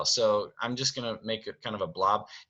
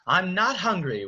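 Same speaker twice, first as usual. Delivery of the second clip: higher-pitched refusal; Belle calling back; 9 semitones higher, 4 dB louder than usual. Acted. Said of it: "we hear from off stage".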